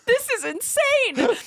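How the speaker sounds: high, slow squeal of disbelief; drawn-out, giddy disbelief; incredulous outburst. Real, not acted. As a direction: exclaim it high, helplessly amused. Laughing in disbelief at story.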